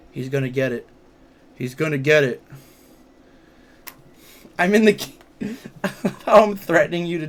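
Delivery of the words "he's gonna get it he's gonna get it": mock threat. Hostage-taker threatens the Wiglet.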